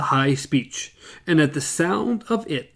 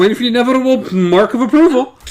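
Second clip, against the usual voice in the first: teasing; 8 semitones higher, 7 dB louder, faster than usual. Real, not acted. Teasing about the check.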